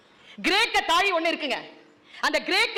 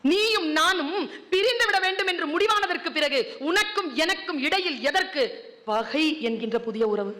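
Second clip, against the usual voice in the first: poetic recitation; 3 semitones higher than usual. Shown as a demonstration. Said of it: "Mir எழுதுகிறான்".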